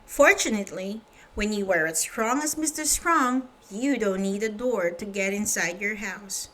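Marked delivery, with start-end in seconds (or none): none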